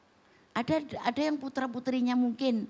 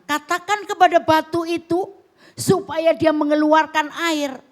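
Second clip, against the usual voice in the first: booming divine command, raised high; commanding shout; 7 semitones higher, 12 dB louder than usual. Acted. Preacher booming God's command to Moses. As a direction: proclaim it like God giving orders.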